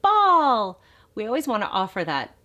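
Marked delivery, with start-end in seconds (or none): 0.0-0.8 put-on high, loud, delighted baby talk; thrilled parent praising a babbling baby; excited praise. Shown as a demonstration. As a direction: cheer the baby on with big excitement. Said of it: "and then get excited".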